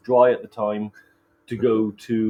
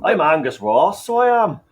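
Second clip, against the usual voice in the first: put-on Irish accent; 6 semitones higher, 7 dB louder than usual. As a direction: put on a light Irish lilt. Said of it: "I went Irish then".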